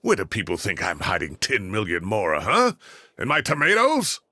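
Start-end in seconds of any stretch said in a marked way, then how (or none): 2.4-4.2 incredulous scoff. Real, not acted. Old man scoffs at ransom demand.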